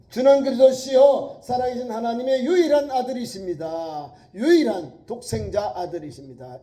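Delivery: loud, high proclaimed confession; confession from the Greek. Acted. Preacher proclaiming the only-begotten Son, loud and high. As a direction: stress 유일한, the only one. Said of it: "헬라어로".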